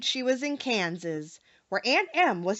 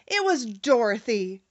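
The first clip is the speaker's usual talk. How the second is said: usual speaking voice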